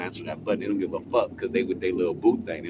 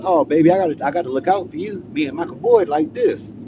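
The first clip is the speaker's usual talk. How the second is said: doting guard; 10 semitones higher, 6 dB louder than usual. Retold or imitated. Guard voiced, promising to help.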